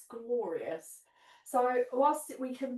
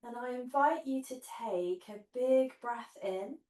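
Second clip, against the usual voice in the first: guided meditation. Acted. Leads guided relaxation on mat.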